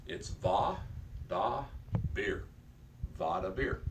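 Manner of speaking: va, da, bir split into separate syllables with pauses. Shown as a demonstration. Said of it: "So it's actually three syllables".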